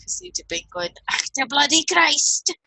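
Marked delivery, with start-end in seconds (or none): 1.1-2.6 slower, higher, louder Scottish oath; angry Scottish granddad. Retold or imitated. Granddad Reed swearing in a Scottish accent, mock angry. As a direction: bark it out like a cross old Scottish granddad. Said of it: "it reminds me of my granddad Reed".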